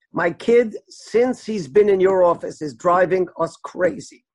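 ordinary delivery, nothing marked

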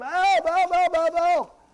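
loud, high, repeated cries for help; acted urgent outcry, one plea on another; screaming out. Demonstrating a plea screamed out to Hashem, urgent cry. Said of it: "wants you to scream out to him".

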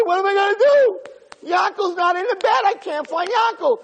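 very high, loud frantic wail; mock panic. Boy can't find himself in bed, comic high-pitched panic. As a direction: wail helplessly like a panicking child.

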